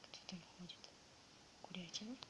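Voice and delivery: normal talking voice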